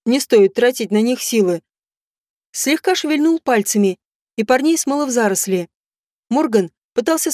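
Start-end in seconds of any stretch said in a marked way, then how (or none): none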